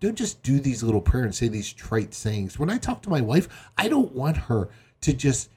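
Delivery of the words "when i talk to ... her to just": ordinary delivery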